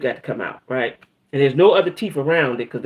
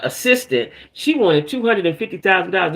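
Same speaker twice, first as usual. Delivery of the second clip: ordinary delivery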